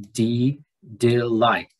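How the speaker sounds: de- said alone, then delight with weight on light; stress on second syllable. Shown as a demonstration. Teacher showing students where the accent falls, delight stressed on light. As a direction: break off after de-, then land on the end of delight. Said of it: "The accent should come on the second syllable".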